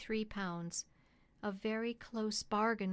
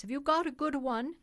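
high, louder called question; crying out; 5 semitones higher, 6 dB louder than usual. A narrator's reading. Cabman calls loudly asking about the new horse. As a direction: keep calling out across to him. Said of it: "cried a voice".

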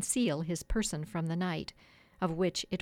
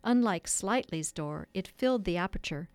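plain reading voice

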